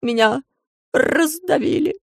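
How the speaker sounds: high, slow, pitiful crow. A narrator's reading. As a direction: squeeze it out like a crushed bird. Dying crow complains.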